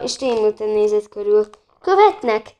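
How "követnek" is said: high, loud burst of surprise; startled question. A narrator's reading. Porter to the frightened guest, startled question. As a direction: shoot up high in disbelief.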